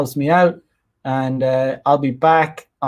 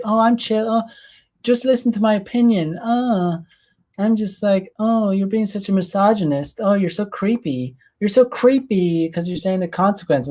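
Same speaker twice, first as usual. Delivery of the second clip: much higher, sing-song mock of a woman playing it cool; mocking fake-chill girl; 6 semitones higher than usual. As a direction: parody her as a falsely calm, preachy woman. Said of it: "pretending to be all like".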